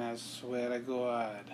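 slow Boston "I swear to God" with a dropped R; Boston accent. Shown as a demonstration. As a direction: stretch "God" into a Boston "Gawd". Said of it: "And my Boston accent".

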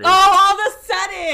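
shrill, loud accusing shout; indignant all of a sudden. Real, not acted. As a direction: call out the hypocrisy. Catching friend being fake.

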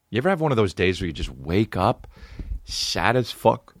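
normal talking voice

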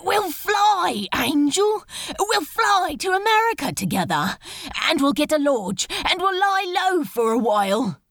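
loud, high and hurried begging; urgent excited plea. Acted. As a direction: rush the plan out, begging. Crowley begs Aziraphale to flee.

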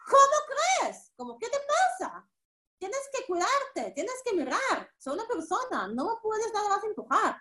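mock indignation. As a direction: play someone huffily scolding a stranger. (indignación fingida, aguda y suave)

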